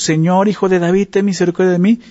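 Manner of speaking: mock cry for mercy. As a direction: call out to Jesus as if begging, lightly. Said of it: "le grita a Jesús".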